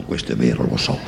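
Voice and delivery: ordinary talk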